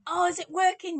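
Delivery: high, loud, eager burst; excited check. Real, not acted. Streamer asking viewers if the mic works, high and excited.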